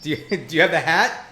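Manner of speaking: ordinary delivery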